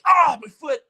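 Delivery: high, loud yelp of pain; hammed-up cry of a foot stabbed by glass; mock pain cry. Burglar stepping on an ornament, a high loud mock yelp.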